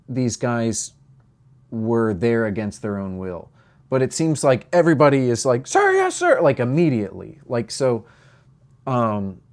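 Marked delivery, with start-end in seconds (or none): 5.7-6.4 high shouted "Sir, yes, sir"; recruit shout. Retold or imitated. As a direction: bark it like a Marine recruit. Quoting recruits answering the sergeant.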